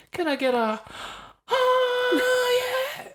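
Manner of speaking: high strained call, voice cracking; mock passenger call. Plane passenger asks the cabin for a cheer, high strained call. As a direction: squeal it like a hopeful plane prankster. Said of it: "it's like".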